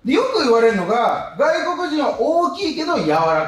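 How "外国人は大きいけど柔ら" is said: slow, spaced setup; slow deliberate. Real, not acted. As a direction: lay the setup out slowly. Deliberate setup line, punchline pacing.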